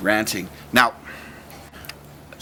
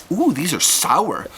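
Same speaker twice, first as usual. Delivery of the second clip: mock foster mom; 6 semitones higher, slower than usual. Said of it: "I hear a kissing".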